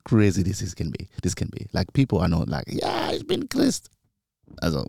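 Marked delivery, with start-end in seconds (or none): 2.7-3.9 mock proud declaration. Mocking a proud churchgoer.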